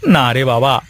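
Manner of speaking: usual speaking voice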